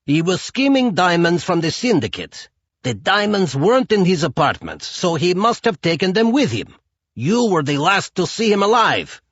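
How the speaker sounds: a mafia boss pressing hard for answers; Chukov the mobster. A narrator's reading. Chukov voiced in the story.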